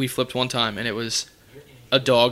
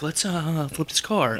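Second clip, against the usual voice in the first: a higher, sudden wild-man Goldblum line; Jeff Goldblum; 4 semitones higher, 4 dB quieter, slower than usual. Retold or imitated. Imagining Goldblum suddenly suggesting a stunt, in a lightly voiced impression. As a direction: toss off a crazy idea like Goldblum, mock-casual. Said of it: "out of nowhere he'd be like".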